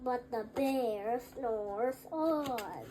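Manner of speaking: drawn-out, slow and low; slow sleepy refrain. A narrator's reading. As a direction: let the line sink into sleep.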